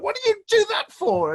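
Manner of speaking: high, loud pained cry; anguished cry. Retold or imitated. To his friend after falling, a high loud pained cry. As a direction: yelp it up high and loud in pain. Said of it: "I said to him".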